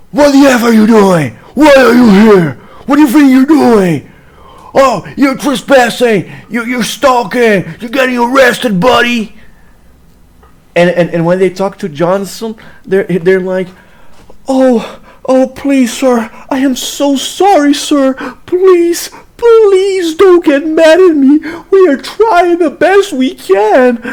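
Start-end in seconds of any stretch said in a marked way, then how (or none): 0.1-9.4 loud, high mock police scolding; harsh police tone. Cops confronting Ben for trespassing, angry mocking shout. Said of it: "how they change tone". 14.5-24.0 high-pitched mock begging, drawn out; grovelling police tone. Cops apologizing to Johnson, exaggerated whiny grovelling. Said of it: "how they change tone".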